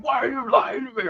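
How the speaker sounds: high-pitched angry yell, imitating a friend; mad retold shout. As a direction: shout the accusation like someone really pissed. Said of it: "he's really pissed".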